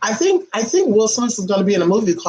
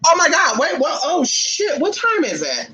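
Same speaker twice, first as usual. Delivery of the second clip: startled panic; 9 semitones higher than usual. Retold or imitated. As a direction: jolt awake in a high shout. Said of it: "and I'm like".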